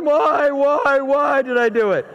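lightly hammed-up self-reproach; remorseful child wail. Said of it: "This poor kid is back there going".

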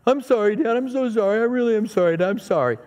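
put-on repentant child. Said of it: "he was repenting".

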